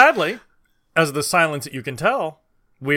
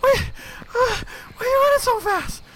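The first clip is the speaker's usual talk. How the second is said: panicky. Acted. Falling behind the runner, pleading.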